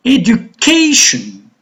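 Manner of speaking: slow "Education" with CA stressed; "Education" slowly, edu-CA-tion; stress on third syllable. Shown as a demonstration. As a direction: punch the CA in "Education", drawn out.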